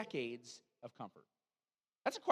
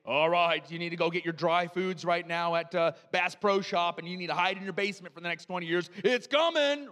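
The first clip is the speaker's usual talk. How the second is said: mock doomsday warning; 8 dB louder than usual. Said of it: "I'm not gonna be one here today to say".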